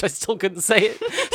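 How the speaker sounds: high, slow, through a real laugh. Real, not acted. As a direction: giggle through the admission.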